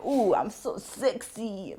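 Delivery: slow, breathy mock seductive; mock sexy. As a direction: purr it like a vamp. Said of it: "like that".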